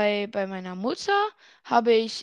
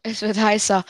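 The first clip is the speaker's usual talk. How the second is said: louder, mocking repeat of his words; teasing echo; 6 dB louder than usual. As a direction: throw his phrase back at him, teasing. Echoes partner's words teasingly.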